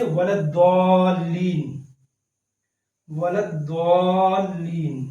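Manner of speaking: वलद्दालीन with heavy dd, slowly drawn; Arabic recitation. Shown as a demonstration. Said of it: "अरबी में पढ़ें तो द्वाद". (अरबी लहजे में धीरे)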